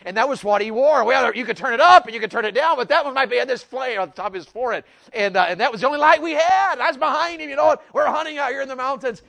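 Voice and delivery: high, loud pulpit storytelling; worked-up preacher's high, loud delivery; full-force preaching. Real, not acted. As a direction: belt the story out high to the back of the hall.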